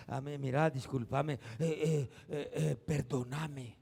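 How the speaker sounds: sheepish apology. Pretend. Timid apology acted out.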